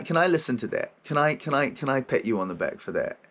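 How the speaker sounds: usual speaking voice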